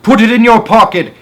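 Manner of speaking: high, loud call; shouting. A narrator's reading. Henry shouting to the old man below. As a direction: shout it down the street. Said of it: "Henry shouted".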